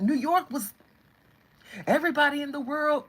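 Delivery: a hint of a bragging New Yorker; self-important New Yorker. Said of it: "But New York is like".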